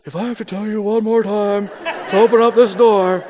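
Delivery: put-on eight-year-old's take on dad's irritated voice; impersonating dad. Son at the locked door, imitating dad's family voice. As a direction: do a kid mimicking a stern parent. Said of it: "he was impersonating me".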